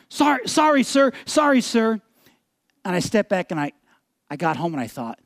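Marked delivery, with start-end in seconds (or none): 0.1-2.0 high, hasty apology; apologetic. Retold or imitated. Kid backing down from an angry driver, apologetic. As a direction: blurt out a mock cowed apology.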